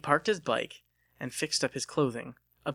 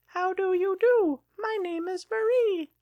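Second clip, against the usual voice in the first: much higher, louder toy doll voice; a wind-up doll greeting the room brightly, pitched way up; talking doll; 5 dB louder than usual. Acted. The wound-up doll introducing itself to the party, high toy voice.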